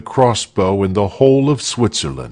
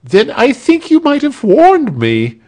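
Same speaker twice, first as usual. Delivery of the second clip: high, loud indignant snap; snapped complaint; 5 dB louder than usual. A narrator's reading. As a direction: snap it in pained annoyance, pitched way up. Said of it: "snapped Arnold of Sewa, dancing with the pain".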